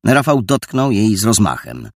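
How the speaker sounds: ordinary delivery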